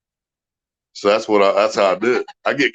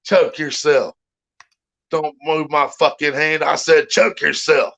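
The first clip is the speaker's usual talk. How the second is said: slow, higher drill sergeant growl; 5 semitones higher, slower than usual. Retold or imitated. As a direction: bark the order, slow and menacing. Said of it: "I've put the drill sergeant in a couple".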